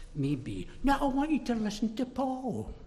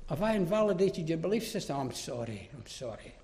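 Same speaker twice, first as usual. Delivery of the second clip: quiet, lower, cringing apology as a caricature; mock apologetic; 4 semitones lower, 7 dB quieter than usual. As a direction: make fun of a spineless preacher. Mocking an apologetic, compromising Paul.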